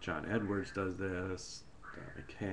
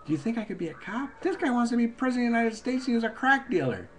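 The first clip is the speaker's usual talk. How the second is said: indignant high rant; 13 semitones higher, 6 dB louder, faster than usual. Real, not acted.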